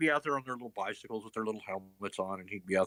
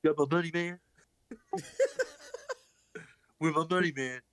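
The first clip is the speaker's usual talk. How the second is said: Stewie impression; 6 semitones higher than usual. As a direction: lean on him for money. Stewie demanding money.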